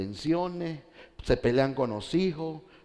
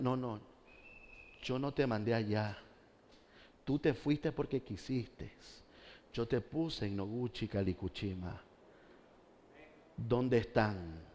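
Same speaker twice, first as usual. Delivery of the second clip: soft, grave voice of the Lord rebuking; quiet, sorrowful divine rebuke; the Lord's reproach; 4 semitones lower, 10 dB quieter than usual. Pretend. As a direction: hush down and rebuke him slowly. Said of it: "y Él me diga".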